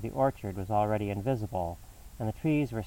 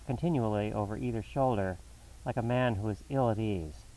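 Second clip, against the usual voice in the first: plain reading voice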